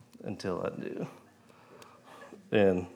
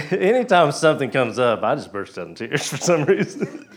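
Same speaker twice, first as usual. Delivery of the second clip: laughing while talking; 4 semitones higher, 6 dB louder than usual. Real, not acted. Laughs while describing bursting into tears.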